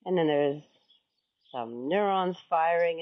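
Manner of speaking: ordinary talk, nothing marked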